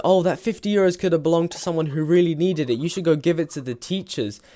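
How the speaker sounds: high, loud, mock-virtuous lecture; imitating smug moralizing kids; mock preachy friends. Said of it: "they said".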